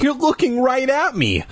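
put-on high, frantic shriek of a scared boy. A narrator's reading. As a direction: cry out in panic, high-pitched. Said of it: "I shrieked".

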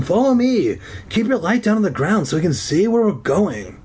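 Zeke's voice. A narrator's reading. Zeke's character voice.

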